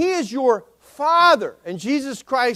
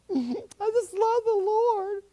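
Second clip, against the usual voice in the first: mock gushing; 12 semitones higher, slower than usual. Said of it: "I'm not talking about".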